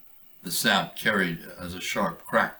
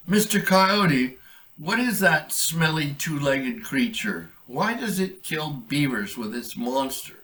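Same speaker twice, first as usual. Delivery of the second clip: higher, slow earnest beaver; 7 semitones higher, 4 dB louder than usual. Acted. Brian the beaver pleading with the coyote for answers. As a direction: ask the coyote with wide-eyed worry.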